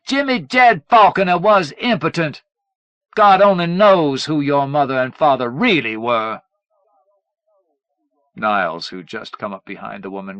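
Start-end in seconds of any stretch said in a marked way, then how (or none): 0.0-6.4 higher, louder shout. A narrator's reading. Mother shouting the secret to the world. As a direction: shout it for everyone to hear. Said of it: "she shouted it for the world to hear".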